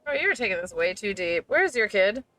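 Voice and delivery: usual speaking voice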